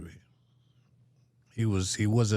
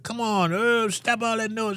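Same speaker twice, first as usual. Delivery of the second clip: neighbors yelling; 13 semitones higher, 4 dB louder than usual. Retold or imitated. Neighbors yelling from adjacent cells.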